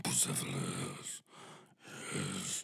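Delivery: deep, slow hushed whisper; crazy horseman whisper. Acted. Alexander whispering his horse's name, deep and hushed. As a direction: breathe the name low and slow. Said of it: "my impression of a crazy horseman".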